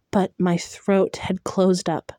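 ordinary delivery

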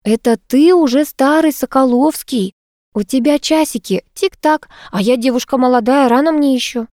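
high-pitched mock-outraged retort; indignant. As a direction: protest theatrically that you are too young. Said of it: "возмущённо взмахнув руками".

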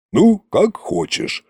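smirking shrug. A narrator's reading. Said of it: "хмыкнул царь-батюшка".

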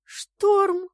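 high, loud cry of surprise; amazed shout; stunned exclamation. A narrator's reading. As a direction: call the name in astonishment. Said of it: "опешила я".

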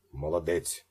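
ordinary delivery

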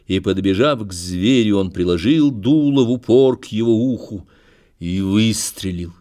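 plain reading voice

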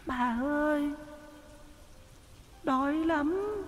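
a hungry little ghost whimpering; plaintive ghost child. A narrator's reading. Ghost child begging the old woman for food, high and faint. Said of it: "Nó cất tiếng nói thê thiết".

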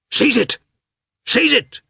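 high, loud urgent shout. A narrator's reading. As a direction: yell the command at the dog. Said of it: "shouted Lievin".

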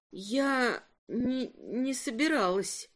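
put-on drunk. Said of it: "Она просто немного пьяна".

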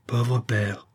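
a touch of sorrow, understated; sighing pity. A narrator's reading. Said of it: "reprit Antoinette en soupirant". (dit en soupirant)

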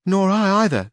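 put-on high, bright young village girl voice. A narrator's reading. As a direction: voice her as an eager young girl. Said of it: "said Sanchica".

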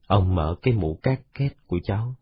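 plain reading voice

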